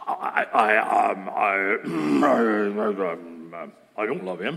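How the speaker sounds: low, halting, stammered imitation; unconvincing denial. Young woman cornered by her family, unconvincing denial. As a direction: stall with throat-clearing, then deny it feebly. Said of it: "Does that sound like she's telling the truth?".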